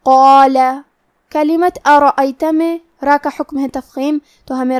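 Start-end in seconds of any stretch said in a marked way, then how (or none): 0.1-0.6 قال with a thick, heavy qaf and full long alif; heavy qaf demo. Shown as a demonstration. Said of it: "موٹی آواز میں پڑھنا ہے".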